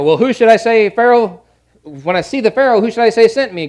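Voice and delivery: high, loud pleading questions; worried questioner. Acted. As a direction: act out an anxious man asking God what to say. Acts out Moses questioning God.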